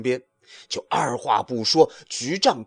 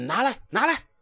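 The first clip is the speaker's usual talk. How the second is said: much higher grabby monkey voice; snatch it back, high and rude; demanding monkey; 12 semitones higher, faster than usual. A narrator's reading. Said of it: "只叫". (假行者尖声索要)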